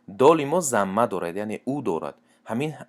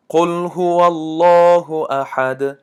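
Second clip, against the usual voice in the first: slow, drawn-out chanted recitation, higher and louder; Quran recitation; 6 semitones higher, 6 dB louder, much slower than usual. Shown as a demonstration. Teacher chanting verses for students, slow melodic recitation.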